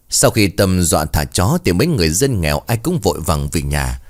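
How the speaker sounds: plain reading voice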